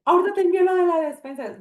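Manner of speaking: high, loud, rushed quote; excited exclamation. Retold or imitated. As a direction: burst out with it high and fast. Retelling excited promise to send money.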